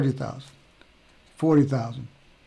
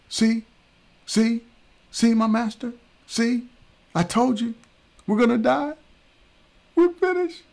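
very high, louder hysterical cry; panicked servant; 10 semitones higher, 3 dB louder than usual. Acted. Gehazi telling Elisha what he saw, very high hysterical cry. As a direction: shriek it like the end has come.

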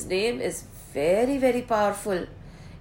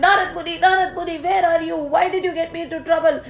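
high-pitched panicked pleading; acted frantic complaint of a frightened king; scared; 7 semitones higher, 3 dB louder, faster than usual. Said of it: "Raja Raj got so scared".

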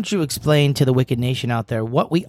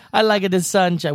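put-on Jamaican accent; 8 semitones higher than usual. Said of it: "What are you, Cool Runnings over here?".

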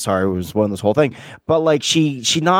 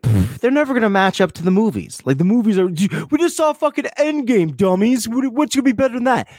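mocking; 10 semitones higher than usual. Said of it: "we're like".

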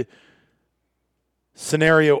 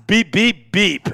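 bleeped out; 6 semitones higher, 5 dB louder, slower than usual. Retold or imitated. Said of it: "You just got bleeped".

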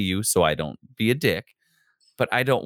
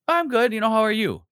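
reflexive cheery reply; 12 semitones higher, faster than usual. Retold or imitated. Reflexive polite answer.

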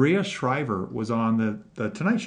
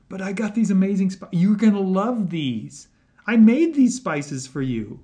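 eager universe; 5 semitones higher, 5 dB louder than usual. Acted. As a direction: plead excitedly, like a cook showing off treats. Voices the universe eagerly offering spices.

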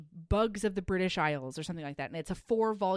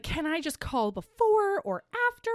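much higher, pleading and fretful; a nervous woman begging to skip the party; anxious heroine; 8 semitones higher than usual. Retold or imitated. Heroine panicking about meeting the naturalist at a ball, high pleading voice.

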